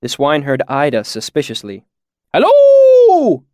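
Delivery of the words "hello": very high, loud, drawn-out holler; shouted greeting. A narrator's reading. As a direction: hail strangers like a swineherd calling his pigs. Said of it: "he shouted".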